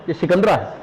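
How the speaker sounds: ordinary delivery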